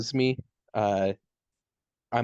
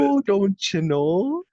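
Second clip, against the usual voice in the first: slow, high, louder mock Canadian accent; 10 semitones higher, 4 dB louder, slower than usual. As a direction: drawl it out as a teasing Canadian. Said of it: "That's what they would say".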